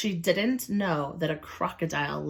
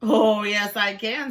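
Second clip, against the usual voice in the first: loud, higher, smug crocodile; gloating crocodile; 4 semitones higher, 5 dB louder than usual. Acted. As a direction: relish the yes. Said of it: "said Crocodile".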